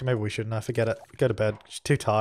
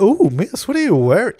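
higher, louder leering tease; mock flirty guy; 8 semitones higher, 7 dB louder than usual. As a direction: ham up a cheesy pickup line. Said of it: "he's like".

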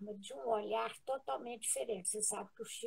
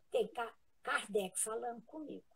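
ordinary delivery

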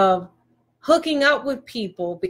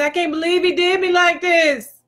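higher-pitched mock lament; mock complaint; 5 semitones higher, 4 dB louder than usual. Woman on the phone to her girlfriends, mock complaining. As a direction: imitate a wronged woman griping, voice pitched up.

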